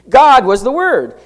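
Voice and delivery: forceful proclamation, "God" punched first; emphatic. Retold or imitated. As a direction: hit "God" high and loud at the front. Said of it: "That is emphatic".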